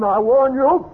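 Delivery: high, loud threatening yell; shouted warning. Acted. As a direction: bellow the threat after him. Threatening man with revolver.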